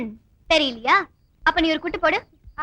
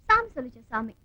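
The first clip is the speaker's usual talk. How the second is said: ordinary talk, nothing marked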